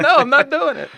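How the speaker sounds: high, loud refusal, mock-indignant; mock argument. Re-enacting an argument about starting the podcast, loud refusal.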